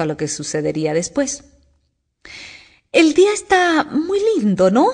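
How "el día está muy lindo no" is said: high, slow, hesitant small voice; timid little voice. A narrator's reading. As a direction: pipe up shyly, drawn out. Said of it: "dijo una vocecita tímida".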